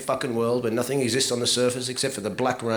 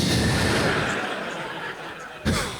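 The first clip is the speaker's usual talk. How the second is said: rising wheezy laugh, much higher; high breathy giggle. Real, not acted. Comic giggling at his own gross example. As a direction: giggle helplessly.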